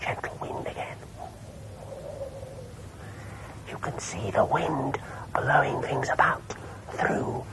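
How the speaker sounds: whisper. Shown as a demonstration. Holds whisper.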